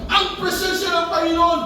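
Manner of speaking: high, fervent preaching; preaching at full force. Acted. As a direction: build to a triumphant amen. Preaching at rising pitch.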